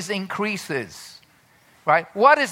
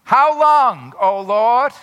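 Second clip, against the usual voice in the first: loud, high anguished plea; psalmist's lament cry; 5 semitones higher, 7 dB louder, slower than usual. Acted. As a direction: cry it up to heaven. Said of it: "he's crying out".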